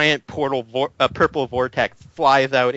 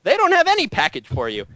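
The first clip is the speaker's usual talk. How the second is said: higher, curt reply; curt professor; 9 semitones higher than usual. Acted. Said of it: "he turns back in the intercom".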